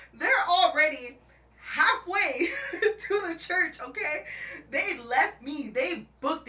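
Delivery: high, loud, slow laugh-talking; can't stop laughing while telling it; laughing through it. Real, not acted. Recounting being left in the truck, laughing hard.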